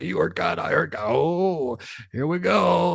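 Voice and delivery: high, giddy disbelief; half laughing, can't believe it; excited disbelief. Retold or imitated. Stunned by a spirit answering.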